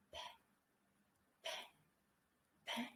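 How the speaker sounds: "Ben" whispered so it sounds like pen. Shown as a demonstration. Teacher whispering Ben to show it turns into P. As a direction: breathe the word with no voice. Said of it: "I try to say the name Ben and I whisper it".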